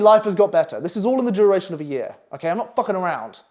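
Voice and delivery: usual speaking voice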